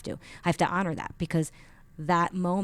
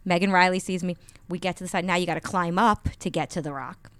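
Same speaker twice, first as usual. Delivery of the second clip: ordinary talk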